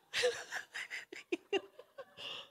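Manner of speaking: high, airless fit of laughter; breathless laugh. Real, not acted. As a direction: laugh until it almost turns to crying. Laughing at husband's words. (halakhak na walang boses, parang naiiyak)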